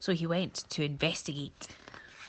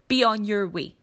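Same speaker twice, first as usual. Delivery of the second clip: louder curt brush-off stressing be; dismissive; 4 semitones higher, 7 dB louder than usual. Acted. Crocodile sends the monkey off, a loud curt dismissal. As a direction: wave him away.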